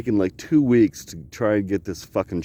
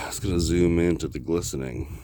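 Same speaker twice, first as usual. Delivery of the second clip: normal talking voice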